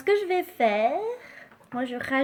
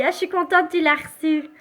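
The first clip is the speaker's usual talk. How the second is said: ordinary delivery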